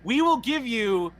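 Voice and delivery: slow, drawn-out announcer delivery; hammed-up commercial plug; fake ad read. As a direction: stretch it like a parody pitchman. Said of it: "time for our fake ad of the day".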